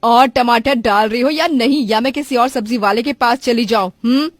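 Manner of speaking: loud threat to walk away; angry customer. Acted. Customer threatening to go to another seller, loud and angry. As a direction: press the seller with a loud ultimatum. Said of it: "customer को इतना गुस्सा होकर".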